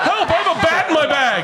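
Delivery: high, loud mock panic; panicked yell. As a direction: shout in a hammed-up panic. Mocking screaming in line.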